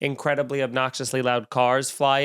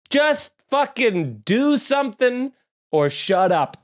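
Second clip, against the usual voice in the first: exasperated rant; 7 semitones higher, slower than usual. Real, not acted. Rant at Russia.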